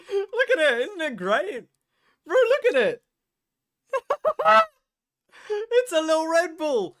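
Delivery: very high laughing squeal; high giddy laughter through the words; giggling squeal. Real, not acted. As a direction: squeal with delight over a tiny can. Squealing over tiny can.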